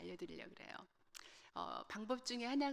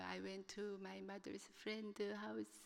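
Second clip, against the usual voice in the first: very slow, halting words, softer; halting broken English; 6 dB quieter, much slower than usual. Retold or imitated. Student struggling through an oral report, halting. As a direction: stumble through it like a nervous student. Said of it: "뜨문뜨문 얘기를 하는 거예요".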